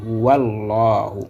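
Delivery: "Huwallahu" in one slow joined run; linked reading. Shown as a demonstration. Teacher gives learners the new reading, "Huwallahu" linked and drawn out. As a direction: stretch "Huwallahu" through the doubled L. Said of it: "une nouvelle lecture qui est".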